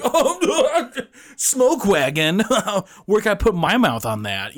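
high, laughing, prissy mock outrage; laughing falsetto clutching pearls; mock scandalized prude. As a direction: squeal it like a shocked prude. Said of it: "People would be like".

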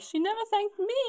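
high-pitched wounded complaint; mock aggrieved. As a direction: play the slighted giver, high. Voicing the unthanked horse sender.

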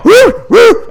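high, loud imitated monkey whooping; piercing mimicked ape calls; baboon whoops. Imitating baboons jumping on car.

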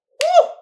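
loud, high whoop; excited whoop. Real, not acted. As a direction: cheer it out. Celebrating the audience.

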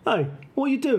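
high-pitched Cockney chatter. Acted. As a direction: pipe up in mock Cockney. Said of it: "kinda Cockney".

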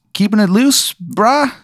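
very high, chill little fly; tiny high bug voice. Acted. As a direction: squeak it like a laid-back insect. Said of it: "He goes".

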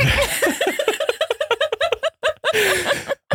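long squealing high laugh. Real, not acted. Host cracks up mid-story, long high laugh. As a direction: fall into a long shrieking laugh. Laughing fit over the jingle.

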